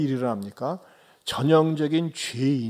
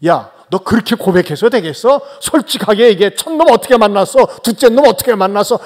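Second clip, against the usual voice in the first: louder, pushy questioning; imagined interrogator cross-examining the woman, loud and nosy; prying interrogation; 3 semitones higher, 7 dB louder than usual. Pretend. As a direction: grill her for every detail. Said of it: "꼬치꼬치 따지지 않고".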